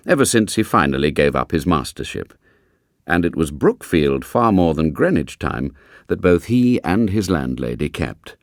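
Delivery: ordinary delivery, nothing marked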